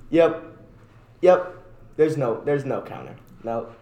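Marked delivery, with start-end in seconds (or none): none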